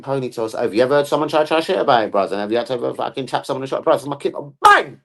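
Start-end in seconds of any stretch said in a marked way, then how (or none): 4.6-5.0 sudden high, loud burst; shouted bang. Acted. Parent mimics a hit landing, shouted bang. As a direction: punch out the one word.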